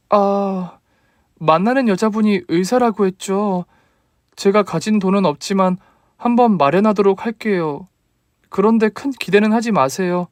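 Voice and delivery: put-on a startled young bride answering her mother-in-law; daughter-in-law voice.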